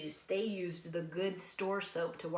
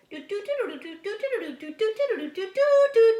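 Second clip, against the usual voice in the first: high, loud sung bugle call, getting louder; bugle imitation; 12 semitones higher, 11 dB louder than usual. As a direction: trumpet it out like an army bugle. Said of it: "on some movies it goes".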